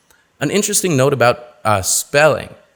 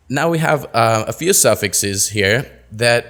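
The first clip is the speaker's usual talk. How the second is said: ordinary talk, nothing marked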